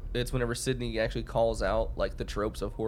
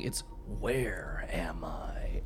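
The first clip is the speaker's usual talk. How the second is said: slow, lower whispered line; 5 semitones lower, 4 dB quieter, much slower than usual. Retold or imitated. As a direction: whisper it eerily. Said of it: "he says".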